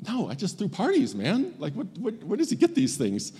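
baffled and a bit offended, defending himself; lightly voiced younger brother protesting innocence. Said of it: "you can hear the younger brother being like".

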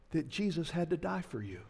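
normal talking voice

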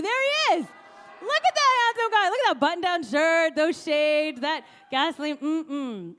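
high, loud, excited fawning; gushing admiration. Real, not acted. Speaker showing the audience a picture of husband, gushing. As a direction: show him off with loud, high delight.

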